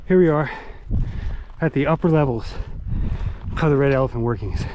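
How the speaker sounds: out of breath after the climb; breathless, panting between phrases; gasping for air. Real, not acted. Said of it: "Sorry, I'm out of breath".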